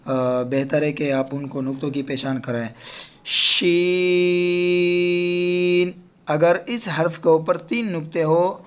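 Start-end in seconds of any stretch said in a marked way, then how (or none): 3.2-5.9 شین held very long; slow model letter name; letter recitation. Shown as a demonstration. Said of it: "اس کو ہم پڑھیں گے شین".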